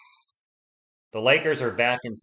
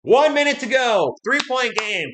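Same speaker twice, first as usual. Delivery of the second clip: excited; 10 semitones higher, 5 dB louder than usual. Real, not acted. Tense final minute, play-by-play.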